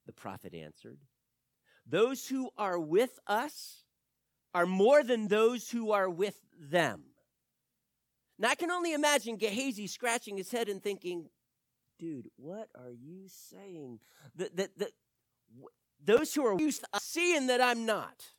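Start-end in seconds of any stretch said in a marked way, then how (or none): 1.8-7.1 slow, loud, steady declaration; bold reassurance. Acted. Prophet reassures, slow and strong. 12.0-14.0 slow, much softer bafflement; confused aside under the breath; puzzled mutter. Acted.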